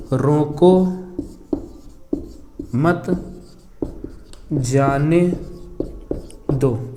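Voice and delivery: one word at a time, long gaps; slow dictation. Real, not acted. Teacher writing the example sentence, slow dictation. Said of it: "लिख लेते हैं simple सा".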